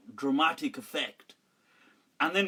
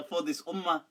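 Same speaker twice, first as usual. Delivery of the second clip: ordinary delivery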